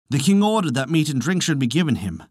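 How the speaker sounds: ordinary delivery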